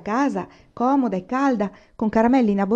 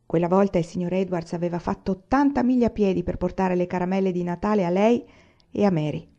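ordinary delivery

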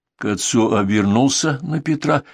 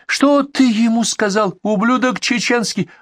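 slow, very high-pitched furious cry; shriek-like ranting, words stretched out; narrated yell; 13 semitones higher, slower than usual. A narrator's reading. Bandit demands to know what was said, yelling high. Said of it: "опять завопил грузин".

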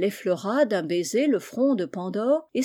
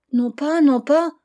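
louder, higher, in mock tears; tearful refusal; 6 semitones higher, 7 dB louder than usual. A narrator's reading. Pandore refusing the voice in the box, acted tearful protest. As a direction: refuse as if crying, voice raised. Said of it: "répondit Pandore en pleurant".

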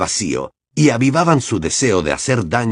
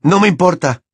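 shouted; 7 semitones higher, 4 dB louder than usual. A narrator's reading. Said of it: "les gritó Harry".

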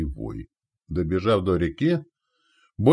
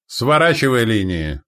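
raised, high commanding call; shouted order; 4 semitones higher, 6 dB louder than usual. Acted. Commander folding up the lines, shouted order. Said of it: "прокричал он, что было сил".

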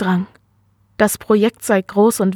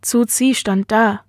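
usual speaking voice

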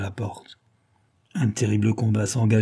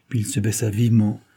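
ordinary delivery

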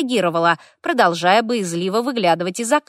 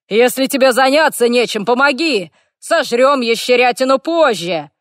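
loud, higher orc shout; 4 semitones higher, 4 dB louder than usual. A narrator's reading. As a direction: bellow for help over battle. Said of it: "крик шамана".

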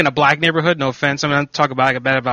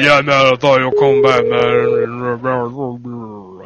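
deep croaking friend on voicemail; bullfrog voice; 5 semitones lower, much slower than usual. Retold or imitated. Imitating a friend's voicemail in a croaky bullfrog voice. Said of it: "That's what he sounds like".

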